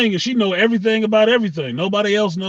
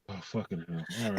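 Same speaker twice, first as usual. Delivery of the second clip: a much softer, fed-up mutter; exasperated groan; 10 semitones lower, 13 dB quieter, faster than usual. Retold or imitated. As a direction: groan it under your breath in disgust. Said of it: "It's like".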